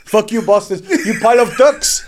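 loud, high cartoon fugitive; hammed-up shooting-back taunt; mock defiant shout. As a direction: yell insults at pursuers, half laughing.

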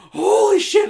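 high, loud startled cry; terrified exclamation. Retold or imitated. Kid seeing the T-Rex on TV, loud high startled cry. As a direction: yelp as if a T-Rex just stared at you. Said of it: "And I'm like".

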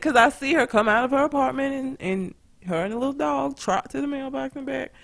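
ordinary talk

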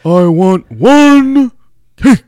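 very slow, loud, high ring-announcer voice; Howard Finkel. Acted. Host imitating a ring announcer on his birthday, booming announcer voice. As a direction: stretch each word like a big arena introduction. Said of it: "You kinda sound like a mixture of him".

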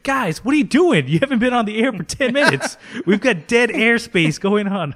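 high, loud, rapid alarm; urgent mock yelling. Staffer bursts in over dead air, high urgent yelling. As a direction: yell at them to get back on air. Acted panicked interruption of colleagues.

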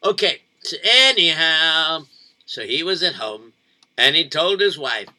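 plain reading voice

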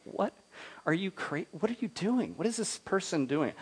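high, hushed mock bewilderment; baffled onlookers. Everybody watching a lone marcher, baffled in a high, soft voice. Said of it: "everybody's like".

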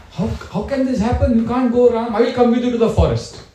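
high, urgent mother's plea; distressed protest. Acted. Kaushalya begs Ram not to go, distressed. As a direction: plead with rising alarm, pitched well up.

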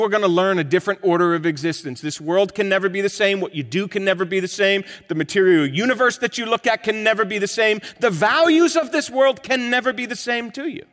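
loud, high preaching refrain; preaching at full force. Acted. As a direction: preach it with rising fervour.

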